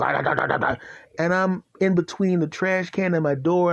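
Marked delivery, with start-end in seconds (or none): 0.0-0.8 rapid-fire bark noise; imitated dog barking. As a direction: bark in a fast steady string. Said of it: "when the dog goes".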